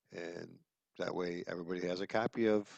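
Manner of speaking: normal talking voice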